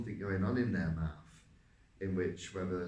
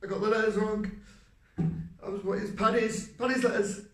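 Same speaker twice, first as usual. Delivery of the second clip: much higher, louder, as if in pain; heightened character voice; 12 semitones higher, 6 dB louder than usual. Shown as a demonstration. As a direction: push the voice high and loud, pained. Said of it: "the heightness in the voice".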